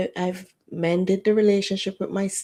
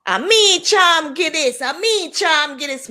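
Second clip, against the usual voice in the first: Jamaican auntie scolding; 9 semitones higher, 7 dB louder than usual. Retold or imitated. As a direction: bellow it like the outraged auntie. Angry aunt voiced in retelling.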